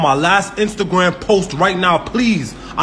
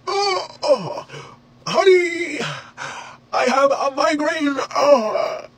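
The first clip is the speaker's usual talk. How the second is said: higher, fast whiny mock sob; mock crying; 6 semitones higher than usual. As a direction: whine it like a fake cry. Mock crying over migraine.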